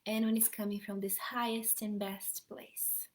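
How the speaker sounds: soothing meditation guide easing the group back; guided meditation. Acted. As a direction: guide the listeners gently inward, letting phrases fade to a whisper.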